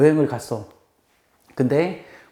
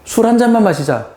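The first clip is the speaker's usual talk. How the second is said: bright, raised coaxing to drink; coaxing invitation; 8 semitones higher, 5 dB louder than usual. Retold or imitated. Friend coaxing at the bar, cheerful and loud. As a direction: coax a friend into just one.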